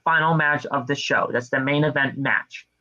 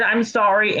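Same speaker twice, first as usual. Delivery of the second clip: mock apology; 6 semitones higher than usual. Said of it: "he said".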